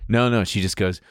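normal talking voice

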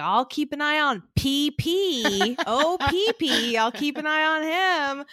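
light put-on granny voice; imitating grandma. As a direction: coo it like a doting grandma watching the baby. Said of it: "She'd be like".

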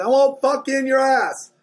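high, loud imitated heckle; heckler yelling. Minotaur heckler at the comic on stage, yelling. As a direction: holler it out like a rowdy heckler. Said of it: "he starts yelling".

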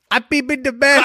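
put-on high baby voice; baby talk. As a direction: toddler confessing to mom. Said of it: "just say".

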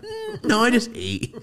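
high-pitched, dainty mock brush-off; imitated polite refusal. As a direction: politely wave off food in a put-on high voice. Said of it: "That's the fucking vibe I got".

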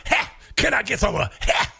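mock Harris voice. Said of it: "Can you do a".

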